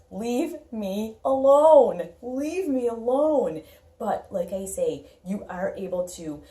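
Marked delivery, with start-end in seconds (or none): 0.1-2.1 loud, higher, very slow leave me alone; screaming leave me alone. Retold or imitated. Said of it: "this screams". 2.2-3.6 loud, higher, very slow leave me alone; screaming leave me alone. Retold or imitated. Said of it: "this screams".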